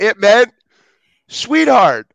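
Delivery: high, loud and emphatic, landing on sweetheart; spring the surprise on her; excited reveal. Real, not acted. Reveals the twist word.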